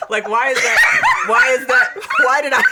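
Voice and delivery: loud, laughing, high-pitched outcry; laughing shout. Real, not acted. Laughing protest.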